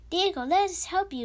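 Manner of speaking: loud, high urgent call; loud pleading cry to Diego. A narrator's reading. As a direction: cry out to reach him. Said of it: "Drake cried".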